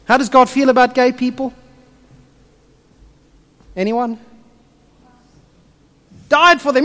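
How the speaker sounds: ordinary talk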